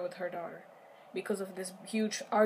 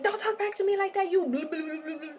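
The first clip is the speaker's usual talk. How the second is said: angry retort; 9 semitones higher than usual. Retold or imitated. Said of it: "She's like".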